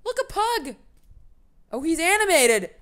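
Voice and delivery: high, loud delighted outburst; excited. Real, not acted. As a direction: exclaim at a thrilling discovery. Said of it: "This excites me".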